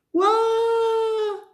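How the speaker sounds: long, very high held wail; acted little-girl bawl on one steady note; mock crying wail. A narrator's reading. Susie crying for her teddy, mock wail. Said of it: "cried Susie, louder than ever".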